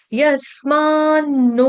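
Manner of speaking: यस्मान्नो drawn out slowly, holding the double n; slow teaching recitation of यस्मान्नो with a doubled न; double n demonstration. Shown as a demonstration. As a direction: stretch यस्मान्नो syllable by syllable, long final o. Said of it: "यहाँ पे double न है".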